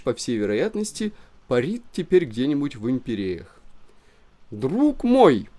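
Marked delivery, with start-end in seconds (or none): 4.5-5.5 muffled call. A narrator's reading. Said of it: "голос Ивана Матвеевича".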